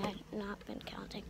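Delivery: unvoiced whispered aside; whispering. Real, not acted.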